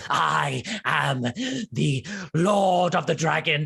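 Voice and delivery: slow, grandiose kobold proclamation; self-important monster, drawing out each title; crazed kobold. Acted. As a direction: declaim like a deranged little dragon lord. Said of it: "foam around his mouth and snout, and he goes".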